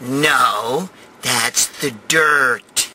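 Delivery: low, very slow, dry correction; deadpan priest. Retold or imitated. Priest correcting the mother-in-law, dry and deadpan. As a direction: state the obvious, utterly unimpressed. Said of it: "So the priest looks at her and goes".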